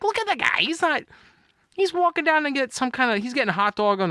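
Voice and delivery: high-pitched defensive protest, lightly acted; retold protest. Dad defending himself over yelling at harmless guy, retold protest. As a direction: argue your case, voice pitched right up. Retelling his defensive reply to companion.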